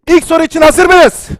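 high, loud excited shout to the audience; too loud, excited. Real, not acted. Host shouting to stream viewers, excited and loud. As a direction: belt out the question with real excitement. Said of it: "sesimi çok yükselttim".

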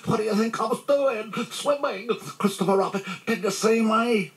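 high squealing little chatterer; excited squeak. A narrator's reading. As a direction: show off to everyone in a tiny squeak. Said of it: "squeaked Roo excitedly".

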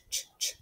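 soft voiceless ch, ch; whispered ch sound. Shown as a demonstration. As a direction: breathe out ch, ch. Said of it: "sound ch".

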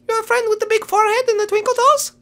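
very high little creature voice; rabbit bearing bad news.

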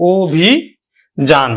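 ও, ভি, যান as separate syllables; slow, held ও ভি, then যান; syllable-by-syllable pronunciation. Shown as a demonstration. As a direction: spell out the sound ও ভি যান. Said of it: "উচ্চারণ করি আমরা কিন্তু".

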